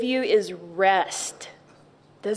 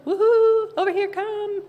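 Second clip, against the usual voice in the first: calling out; 11 semitones higher than usual. Pretend. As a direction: shout out to her like you're waving her over. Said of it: "just come".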